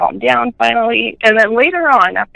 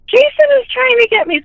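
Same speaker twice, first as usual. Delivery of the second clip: high-pitched imitation of a hysterical little boy; retold hysteria. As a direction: mimic a terrified child's wail, much higher. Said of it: "he was just hysterical".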